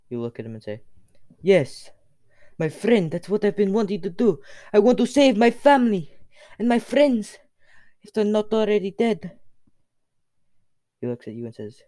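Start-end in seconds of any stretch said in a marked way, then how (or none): 1.4-9.4 higher, louder voice for a fervent hero; impassioned character. Acted. Hero begs Death to save family and friends, impassioned. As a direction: plead it high and loud.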